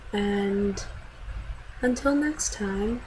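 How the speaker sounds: soft, slow put-on outro voice; mock sign-off. Vlogger signing off to her viewers in a soft, slow put-on voice. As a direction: drag out the farewell quietly, in a put-on voice.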